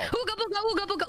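high silly chanted call; mating call. Pretend. Streamer performing a mock mating call, high and silly. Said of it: "perform a mating call".

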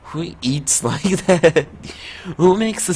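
cracking up at the noise; giggling incredulous question; laughing in disbelief. Real, not acted. Reacting to eating noise.

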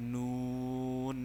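নুন drawn out very long; Arabic nun demo. Shown as a demonstration. Teacher models নুন for students, slowly stretched.

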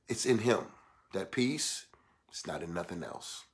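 low, very soft reverent delivery; hushed preaching. Real, not acted. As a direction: bring the sermon down to a hush. Hushed settling after preaching.

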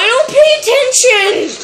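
high-pitched yell that cracks. Real, not acted. Player insists he doesn't pay attention, voice cracking. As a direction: push it high until the voice cracks. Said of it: "Voice crack!".

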